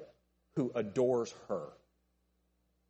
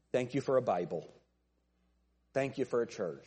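earnest, simple thanksgiving prayer to the Lord; 2 semitones lower than usual. Real, not acted. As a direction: pray it plainly, item by item, gratefully. Pastor prays aloud, giving thanks.

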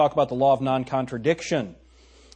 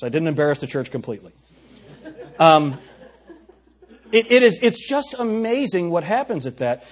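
usual speaking voice

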